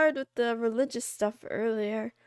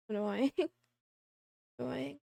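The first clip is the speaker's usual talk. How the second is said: low mock voice; 4 semitones lower, 6 dB quieter than usual. Low voice reacting to chat.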